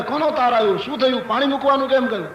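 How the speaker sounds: high-pitched rush of questions; acted flustered urgency, quickening at the end; hurried, anxious. Wife rushing in to ask about the telegram, hurried. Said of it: "ઉતાવળી ઉતાવળી આવે".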